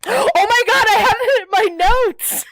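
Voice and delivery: high, delighted gasp and rush; gasping with sudden delight; excited gasp. Real, not acted. Gasping as her co-host mentions Giles in a cardigan, thrilled.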